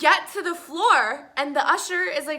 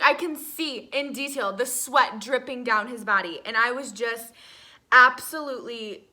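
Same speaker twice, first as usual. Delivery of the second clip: ordinary delivery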